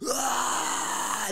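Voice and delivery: loud, high cry of release; crying shout. Retold or imitated. Man swinging the bat at the retreat, a crying shout. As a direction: let everything out in one wailing yell. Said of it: "I was just like".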